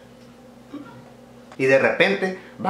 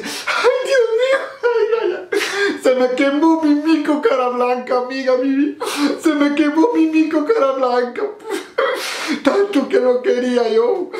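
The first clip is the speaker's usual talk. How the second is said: wailing woman; 11 semitones higher than usual. Acted. Woman fleeing the fire to her friend, high mock wail. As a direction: wail it like a distraught woman, half laughing. Desperate woman whose pet burned.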